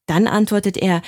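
ordinary delivery

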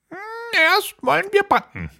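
scheme in a very high witch voice; scheming old witch. Acted. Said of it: "sagte die Alte". (listige alte Hexe)

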